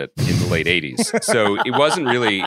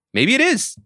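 high, playful mock-mysterious; teasing mystery; 3 dB louder than usual. Co-host teasing listeners the rumor is true, mock-mysterious. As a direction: tease that it could be true.

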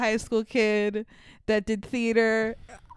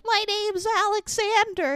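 put-on small boy's higher voice; higher little-boy voice; donkey boy voice; 10 semitones higher than usual. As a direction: lightly voice a scared little boy. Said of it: "And he's like".

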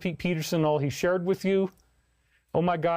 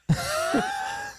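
shrieking laugh. Real, not acted. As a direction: burst of high shrieking laughter. Sudden loud laugh outburst.

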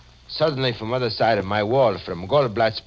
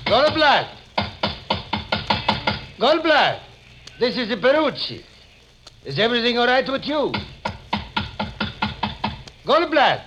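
calling out; 10 semitones lower, 3 dB louder than usual. Acted. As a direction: call his name loudly through the door. Shopkeeper calling at locked door.

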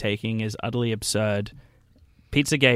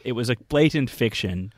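normal talking voice

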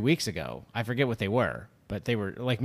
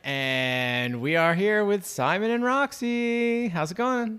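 slow, higher announcer welcome; podcast host intro; 7 semitones higher, 3 dB louder, slower than usual. Acted. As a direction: open the show like a host.

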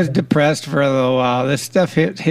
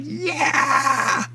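excited yeah; 11 semitones higher, 6 dB quieter than usual. Retold or imitated. Excited cheer at finally hearing back.

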